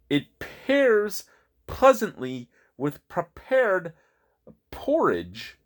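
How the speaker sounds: slow, punchy alliterative reading; measured tongue-twister delivery with pauses; theatrical label read. Acted. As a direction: read it out slowly, popping each word.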